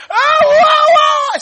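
wordless high wail at full volume; screaming. Acted. Preacher acting the woman screaming after Jesus, full scream. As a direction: scream it out, long and piercingly high. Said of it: "You are screaming".

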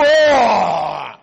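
a big loud "Oh!" to frighten; mock scary roar. Shown as a demonstration. Preacher showing the scare that works, loud "Oh!". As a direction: belt "Oh!" like a child playing monster. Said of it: "if they do".

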